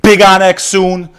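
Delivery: big, high, slow hype call; rallying hype. Real, not acted. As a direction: stretch it out like a rally chant.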